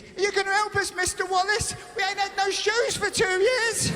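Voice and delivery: high pleading voice; mock pleading kid. Comic as the kids begging Greg for help. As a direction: beg like a neglected child. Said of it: "There's the kids".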